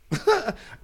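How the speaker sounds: a high nervous burst of laughter. Real, not acted. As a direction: laugh in sudden panic. Panicked laugh at probation call.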